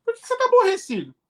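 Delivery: pergunta aguda e rápida, encenada; pergunta insistente. Acted. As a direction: encenar alguém cobrando resposta, em tom agudo. Diálogo encenado, alguém questiona. (cobrança encenada, aguda e apressada)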